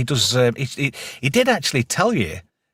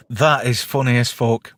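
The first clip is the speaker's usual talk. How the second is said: ordinary talk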